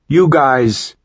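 a high, loud yell into the silent night. A narrator's reading. Connor calls to the ship in the dark, yelling loud. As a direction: shout for the friends across the frozen yard. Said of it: "he yelled".

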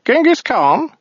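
high, mock-formal alien voice; formal address, pitched high; weaselly voice. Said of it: "he said".

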